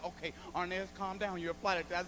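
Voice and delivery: calming voice. Retold or imitated. Coworker hushing the comedian, mock soft and calm. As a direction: lightly imitate a hushed coworker. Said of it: "She said".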